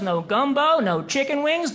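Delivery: ordinary talk, nothing marked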